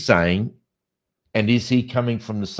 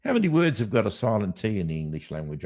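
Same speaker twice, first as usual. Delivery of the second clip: normal talking voice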